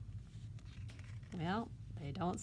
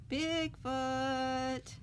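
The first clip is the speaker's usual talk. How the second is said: calling out; 7 semitones higher than usual. Acted. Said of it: "he's calling out".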